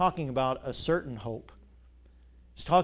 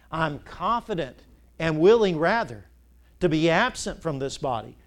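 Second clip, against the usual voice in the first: preaching; 4 dB louder than usual. Acted. Preaching scripture.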